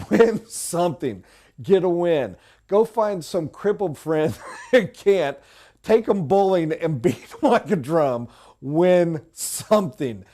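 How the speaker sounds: chuckling through a cheeky joke; laughing. Real, not acted. Sales coach laughing as he urges his team to win something, chuckling. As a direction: laugh while pushing the point.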